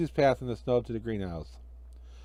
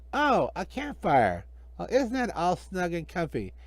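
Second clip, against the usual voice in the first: higher, slow, gloating magician voice; sly magician; 5 semitones higher, 3 dB quieter than usual. Acted. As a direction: gloat over the little fire. Villain Hinkle voiced.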